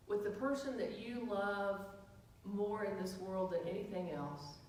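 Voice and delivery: soft, hushed, dreamy scene-painting; lightly hushed romantic imagining; soft storytelling. Acted. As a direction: draw them gently onto the beach. Guided romantic beach imagery.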